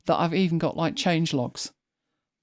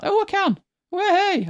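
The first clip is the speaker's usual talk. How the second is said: much higher, delighted surprise; surprised; 3 dB louder, slower than usual. Real, not acted. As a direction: squeal of happy disbelief. Discovers the like button.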